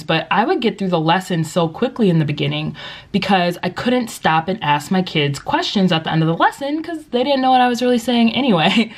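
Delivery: ordinary talk, nothing marked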